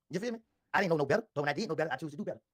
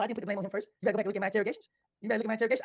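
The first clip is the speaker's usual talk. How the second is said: normal talking voice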